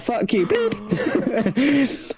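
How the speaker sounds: high, slow, laughing mock retort; mock indignant voice. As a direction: sneer it back in a high voice. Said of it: "People are like".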